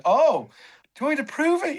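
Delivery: high, teasing offer to prove it; cheeky challenge. Retold or imitated. Chris Hoy dares the comedian, teasing and high. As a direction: dare him with a lift at the end.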